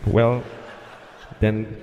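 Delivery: low, slow, halting clearance with long pauses; reluctant controller. Retold or imitated. Controller reluctantly clearing the plane to land, low and halting. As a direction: give in hesitantly, word by word, in a deep voice. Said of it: "That's how he said it".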